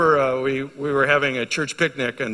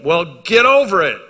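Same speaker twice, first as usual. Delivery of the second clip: louder, slow, then high on Get healed; forceful command; 7 semitones higher, 8 dB louder, slower than usual. Acted. Preacher to men with absent fathers, loud rising command. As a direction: bark the remedy at them. Preacher confronting wounded listeners.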